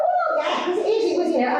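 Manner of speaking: very high embarrassed laugh through the words. Retold or imitated. Tutor laughing off the mum's remark, high and awkward.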